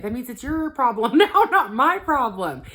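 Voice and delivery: half-laughing told-you-so; laughing exasperation. Retold or imitated. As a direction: laugh it off while making the point. Laughing through retold inner retort.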